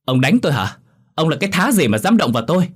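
high, hurt outburst; acted outraged daughter; wailing indignation. A narrator's reading. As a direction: protest the slap with pitch leaping. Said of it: "miệng bù lu bù loa".

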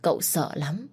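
plain reading voice

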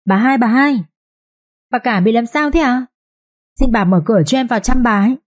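high, urgent calls through the door; calling out loudly. A narrator's reading. Nanny calls through the door to the second wife, urgent. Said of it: "vừa gọi rõ to".

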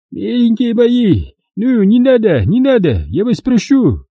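high, slow weepy begging; tearful plea for mercy. A narrator's reading. The trader begging his captors for mercy, tearful. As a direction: cry out for mercy, drawn out. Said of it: "Моля пощады, заплакал барыга".

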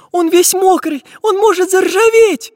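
put-on very high, loud, worried girl's voice; little girl fretting. A narrator's reading. Girl worrying over her wet iron friend, fretting. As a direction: fret like an anxious little girl. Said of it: "переживала девочка".

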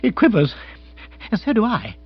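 high, jittery character voice; agitated lord in a twitter; quivering nerves. Acted. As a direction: pitch it up, all nerves. Bill describes his own twittering nerves.

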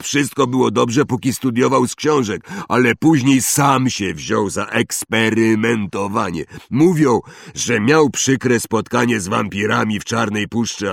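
hearty, sympathetic big fellow; chatty Hagrid. Acted. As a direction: confide gossip in a hearty voice. Hagrid's figure in the story.

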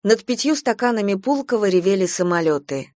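ordinary delivery, nothing marked

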